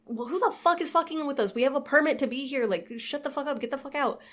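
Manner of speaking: high-pitched imitated outrage, not shouted; mock indignation. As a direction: act out an indignant rant at intruders. Voicing Houston's outburst at intruders.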